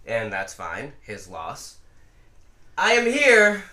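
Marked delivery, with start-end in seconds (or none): 2.8-3.6 loud announcement. Real, not acted. Announcing himself on the podcast.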